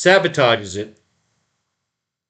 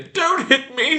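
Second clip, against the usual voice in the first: high pleading whimper; slower than usual. Acted. Cowering man begs.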